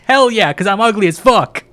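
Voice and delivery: high, loud shouted retort; brash mock pride. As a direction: yell it back proudly at the game. Loud retort to game text.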